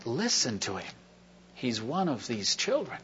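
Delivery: soft inner voice of the Lord; hushed, gentle divine urging; a quiet word from God; the Lord's quiet voice. Retold or imitated. The Lord speaking to him.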